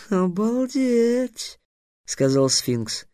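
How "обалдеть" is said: slow, drawn-out astonishment; amazed. A narrator's reading. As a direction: stretch out the wonder. Sphinx stunned.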